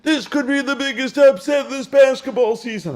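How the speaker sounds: high, loud, cracking hype-announcer voice; Dickie V impression. Host mimics Dickie V hyping the upset, high and loud. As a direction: sell the upset like a frantic old TV analyst. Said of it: "I'll try to do his voice now".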